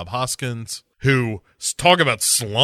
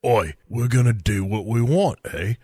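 cockney defiance. Retold or imitated. Spice Girls to [name], defiant mock cockney. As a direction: talk back in put-on cockney.

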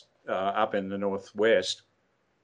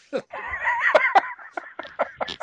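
high burst of laughter. Real, not acted. Laughing at own story.

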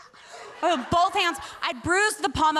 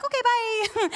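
high sing-out goodbye; breezy send-off; 8 semitones higher, slower than usual. Retold or imitated. Speaker dismissing those who won't listen, breezy send-off. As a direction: wave them off lightly in a raised pitch.

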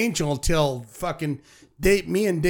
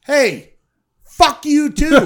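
louder and higher, mock shouted comeback; telling friends the comeback he gave a rock star, louder and higher; retold defiant shout; 9 semitones higher, 9 dB louder, slower than usual. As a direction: fire the comeback back at him, hammed up. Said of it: "You know what I said to him".

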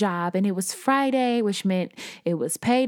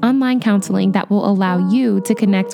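louder, upbeat sponsor read; polished podcast ad delivery; 7 dB louder than usual. Real, not acted. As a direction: read the sponsor message brightly and firmly. Sponsor read over music.

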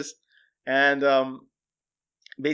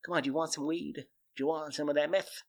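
creepy coaxing; 8 dB quieter than usual. Retold or imitated. Joe quietly coaxing the new husband with weed and meth. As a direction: sweet-talk him quietly into it. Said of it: "you can hear his voice say".

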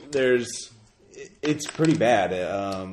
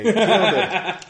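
laughing; 10 semitones higher, 8 dB louder, much slower than usual. A narrator's reading. Laughing retelling.

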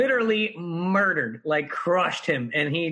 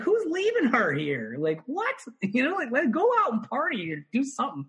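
incredulous; 9 semitones higher than usual. Retold or imitated. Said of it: "And I was like".

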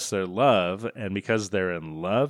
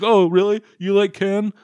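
higher and louder sarcastic jab; mocking; 9 semitones higher, 6 dB louder than usual. Dad teasing her about Ken, sarcastic mocking tone. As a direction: sneer it like it's obvious. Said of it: "He's like".

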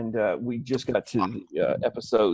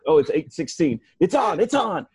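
excited; 5 semitones higher than usual. Retold or imitated. Retelling excited Twitter countdown.

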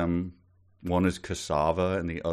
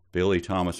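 ordinary delivery

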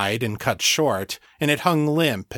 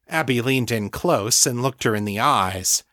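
ordinary delivery

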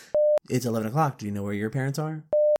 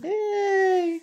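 excited cheer. Real, not acted. Cheering at free audiobooks.

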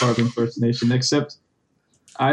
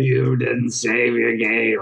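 zombie voice; 6 semitones lower, slower than usual. Retold or imitated.

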